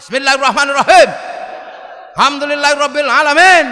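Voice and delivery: thundering Quran recital; Umar's thundering recitation. Retold or imitated. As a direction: blast the opening verse like a stern warrior. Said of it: "Sayyidina Umar kalau baca Quran".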